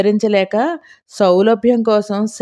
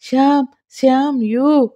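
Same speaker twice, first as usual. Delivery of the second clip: hiccupping sobs; 5 semitones higher than usual. Acted. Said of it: "వెక్కిళ్లతో".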